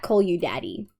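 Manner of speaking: usual speaking voice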